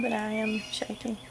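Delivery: ordinary delivery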